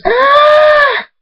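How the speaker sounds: very high, piercing shriek; long, shrieked cry of effort; labor scream. A narrator's reading. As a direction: scream through the final push. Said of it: "Fluttershy screams".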